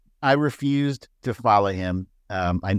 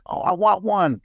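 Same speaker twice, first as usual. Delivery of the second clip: eager envy; 6 semitones higher than usual. Retold or imitated. Quotes own envy of blocked users.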